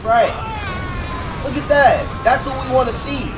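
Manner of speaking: loud, high exclamation of approval; excited approval. Real, not acted. Reactor cheering on the clip he watches, excited approval.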